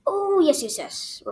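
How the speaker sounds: high, loud "oh", then tumbling yeses; very excited. A narrator's reading. Little Tim Tim accepts California trip, very excited. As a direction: burst out eagerly. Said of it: "shouted Little Tim Tim, very excited".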